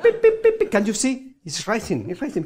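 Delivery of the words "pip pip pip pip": squeaky high pips as the card rises; high, loud cartoon pips; playful high beeping to sell the magic; high pip sound effect.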